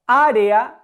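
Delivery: "Área" with the first "Á" emphasised; stress on A. Shown as a demonstration. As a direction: lean into the opening vowel of "Área". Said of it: "la sílaba en la que recargo la voz es a". ("Área", recargando la voz en la "á" inicial)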